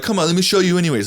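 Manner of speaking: high, loud pushy sales pitch; loud mock sales push; pushy salesman. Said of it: "I hate when people are like".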